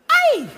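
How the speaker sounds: sudden shrill-pitched yelp; high yelp. Retold or imitated. Comic playing a porn actress yelping Ay. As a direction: squeal out a high startled Ay. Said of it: "another one would go".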